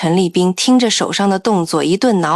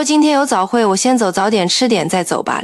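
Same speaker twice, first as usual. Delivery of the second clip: usual speaking voice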